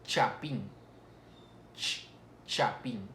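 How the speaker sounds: chapín with the CH isolated, then the word; slowly demonstrated CH of chapín; CH sound. Shown as a demonstration. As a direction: show the CH in chapín, ch- on its own first. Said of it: "aquí la lengua se dobla un poco más".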